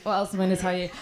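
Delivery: ordinary talk, nothing marked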